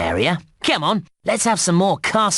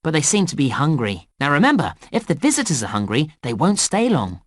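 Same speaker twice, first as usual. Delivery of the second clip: ordinary delivery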